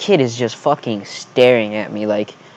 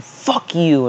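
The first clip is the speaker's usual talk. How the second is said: higher-pitched mock outburst; mildly raised, hammed-up defiance; imagined defiant retort; 5 semitones higher than usual. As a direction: throw it out as a pretend comeback.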